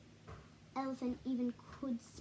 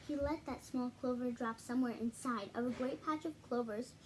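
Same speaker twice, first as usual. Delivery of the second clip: usual speaking voice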